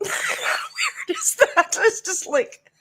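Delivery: high, slow words through a laugh. Real, not acted. Host marvelling to viewers at the coincidence, laughing. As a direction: squeal it while laughing.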